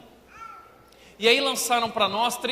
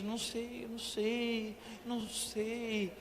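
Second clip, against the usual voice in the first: much softer, slow, lost and unsure; insecure girl; 2 semitones higher, 11 dB quieter, slower than usual. Retold or imitated. Insecure teen mimicking the Divergent heroine, soft and slow. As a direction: trail off like a girl who can't decide. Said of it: "toda insegura".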